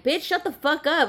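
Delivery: high, loud snap at a noisy car; annoyed outburst. Acted. Woman telling off a loud muffler, annoyed outburst. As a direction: shout it in mock irritation.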